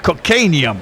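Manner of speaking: mock yell in a hammy Russian accent; bad Russian accent. Commentator mimicking Arnie's film line, bad Russian accent. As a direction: imitate a badly faked Russian accent. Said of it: "yells in a bad Russian accent".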